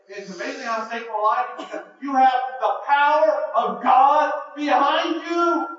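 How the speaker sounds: loud, high, driving sermon climax; preaching at full force. Acted. Preacher rousing the congregation, loud and fervent.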